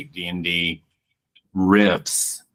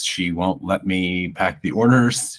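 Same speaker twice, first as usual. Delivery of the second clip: ordinary talk, nothing marked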